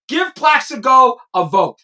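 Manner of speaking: ordinary delivery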